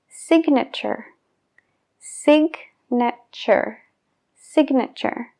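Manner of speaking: "Signature" with a clear G, sig-na-ture, middle take slow; G pronounced. Shown as a demonstration. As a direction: sound the G in "Signature". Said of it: "pero en el sustantivo sí". ("Signature" con la G pronunciada)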